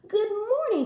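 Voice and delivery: put-on high-pitched girl's call; cheery little girl calling out. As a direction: greet the forest animals in a high voice. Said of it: "she called to the rabbits".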